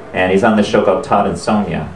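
usual speaking voice

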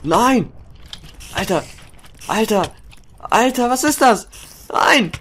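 high, loud panicked yells; panicked screaming. Real, not acted. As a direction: scream in fright. Attacked by a monster.

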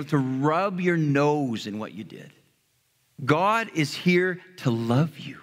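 ordinary talk, nothing marked

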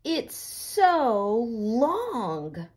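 very slow, drawn-out mock; teasing child. Classmate teasing Chrysanthemum about her long name, slow mocking drawl. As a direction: stretch it out to tease her.

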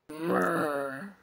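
shivery groaning brr. Acted. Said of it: "the twins groaned".

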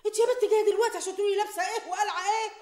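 scolding. Acted. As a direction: scold the crying daughter. Mother scolding tearful daughter.

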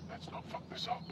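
under-breath whisper to himself; quiet. Real, not acted. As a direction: hushes it while rolling carefully. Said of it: "For the sake of the Pothead podcast".

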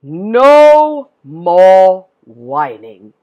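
loud, very slow, spaced-out words; firm declaration. A narrator's reading. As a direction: declare it word by word, drawn out. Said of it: "he declared".

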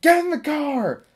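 high commanding call out a car window; barked order. Retold or imitated. Driver calling to neighborhood kid, high bossy command. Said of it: "she's like".

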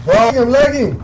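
loud frustrated yell; hyped gamer bro. Real, not acted. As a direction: shout it at the lag. Frustrated lag complaint.

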